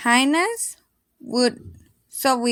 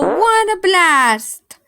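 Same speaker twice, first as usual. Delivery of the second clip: loud and slow, drawn out with glee; a loud gleeful reveal; excited exclamation; 5 semitones higher, 5 dB louder, slower than usual. A narrator's reading. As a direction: savor the punchline, big and slow. Pumbaa's fart described.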